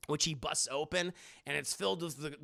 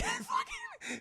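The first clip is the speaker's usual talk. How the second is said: laughing; 3 dB quieter than usual. Real, not acted. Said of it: "[name] starts laughing".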